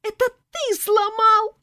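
high, loud accusing cry; ringing child's shout. A narrator's reading. Child accusing another of breaking it, ringing shout. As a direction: shout the blame like an outraged kid. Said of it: "звонко кричал детский голос".

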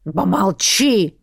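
slow, stern shout to hush; snapping. A narrator's reading. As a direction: cut the cat off sharply. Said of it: "прикрикнул на зашипевшего кота".

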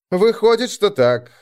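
light, high-pitched breezy reply; carefree. A narrator's reading. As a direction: toss it off carelessly, starting high. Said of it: "беспечно отозвался Мороз".

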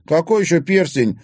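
high, loud, alarmed question; horrified. A narrator's reading. Timofey accused over a missing ring, horrified. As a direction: ask in shocked disbelief. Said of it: "в ужасе выдохнул Тимофей".

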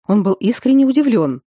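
ordinary delivery

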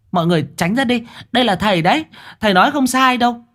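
a high, insistent woman rising as she goes; urging woman. Acted. Hạnh urges the crowd. (giọng đàn bà cao vút, giục đám đông tránh ra)

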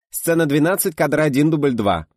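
high, loud clapperboard call; slate announcement. A narrator's reading. Assistant announces the second take, loud slate call. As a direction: announce the take officially to the whole set.

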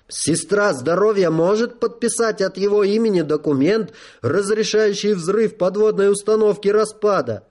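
reedy, pushy general voice; high-pitched general. A narrator's reading. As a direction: press her in a high voice. (высокий голос генерала)

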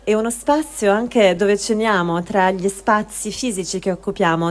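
normal talking voice

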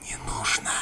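creeping nightmare whisper; a demon hissing from the dark. Acted. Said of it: "шипя добавила кобра Фохт".

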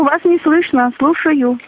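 ordinary talk, nothing marked

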